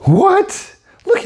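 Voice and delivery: very high squeaky what; sudden delighted disbelief; shocked. Real, not acted. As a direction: yelp of surprise. Star effect surprises.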